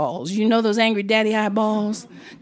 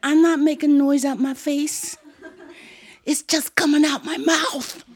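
lightly voiced high child's protest; 6 semitones higher than usual. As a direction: correct the aunt with a child's logic.

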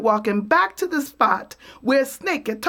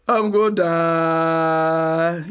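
slow mournful moan; 5 semitones lower, much slower than usual. Acted. Possum moans.

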